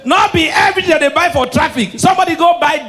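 high, loud warning; emphatic shout. Real, not acted. Comedian warns the crowd about traffic sellers, loud and emphatic. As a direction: hammer the warning home.

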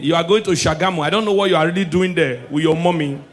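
usual speaking voice

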